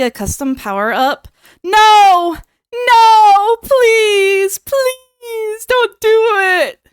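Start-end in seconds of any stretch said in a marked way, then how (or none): 1.6-6.8 high, loud melodramatic wail; hammed-up cry of protest. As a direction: wail it like a fake tragedy. Begging against custom power-up.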